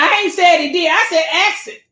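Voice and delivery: ordinary talk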